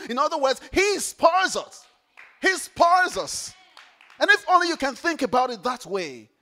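loud, rousing sermon build; preaching at full force. Acted. Preacher rousing the congregation, loud. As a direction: preach it out big to the room.

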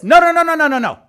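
loud rapid string of refusals, easing off; urgent correction. Acted. God stopping someone rushing to build, firm interruption. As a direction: cut them off firmly.

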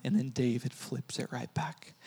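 whispered softly, like telling a secret. Real, not acted. Preacher whispering the turn of the story to the congregation. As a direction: breathe it out in a whisper.

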